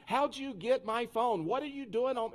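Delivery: high-pitched accusing interrogation; acted-out indignant grilling; snapped. Man grilling girl who has his phone, snapping. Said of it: "I snapped".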